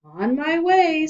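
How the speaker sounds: slow, high, loud cheerful reply; eager boy. Acted. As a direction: chirp it out happily.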